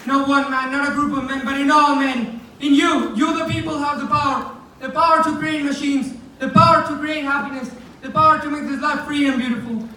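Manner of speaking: rising fervour, declaiming to the crowd; passionate oration. Acted. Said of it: "final speech from The Great Dictator".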